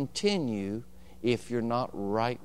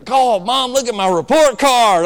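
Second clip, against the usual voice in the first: high, loud show-off sister; bragging sister; 10 semitones higher, 13 dB louder than usual. Retold or imitated. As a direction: shout it out to show off to Mom. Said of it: "Denise would say".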